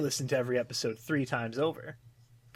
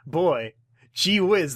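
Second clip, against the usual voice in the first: slow, louder gee-whiz delivery; mock enthusiasm; 3 semitones higher, 7 dB louder, much slower than usual. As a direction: drag out the fake wonder. Retold mock enthusiasm about a finale.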